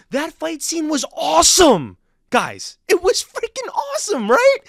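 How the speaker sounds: very high, excited near-shout; ecstatic fan raving. Real, not acted. Fan raving to viewers about a fight scene, ecstatic. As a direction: burst out high and thrilled, pushing for agreement.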